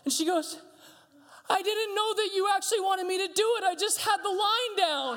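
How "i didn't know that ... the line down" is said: put-on high-pitched wailing child; mock bawling. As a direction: imitate a kid protesting through tears. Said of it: "bawling immediately, bawling".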